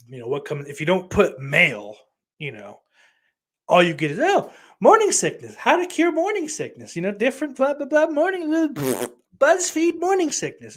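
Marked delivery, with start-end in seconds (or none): 4.2-10.8 mock search results voice. Mocking Google search results.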